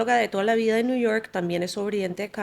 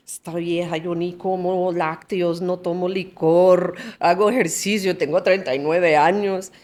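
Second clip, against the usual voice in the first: indignant, louder and slow protest; offended; 5 dB louder than usual. Retold or imitated. Retelling her offended reaction to the doctor, indignant. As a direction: scoff like she's insulted.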